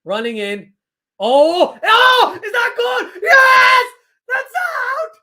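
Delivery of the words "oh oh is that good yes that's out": high, loud screaming shout of disbelief; a real shriek that breaks the voice. Real, not acted. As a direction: shriek at the wicket falling, voice cracking. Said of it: "Sorry for the scream".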